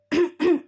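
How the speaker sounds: pointed little throat-clear. Acted. Character coughs into his fist to interrupt. (गला खँखारते हुए)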